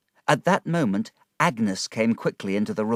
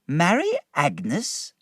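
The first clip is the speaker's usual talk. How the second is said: startled high question; 8 semitones higher, slower than usual. A narrator's reading. David shocked by suggestion.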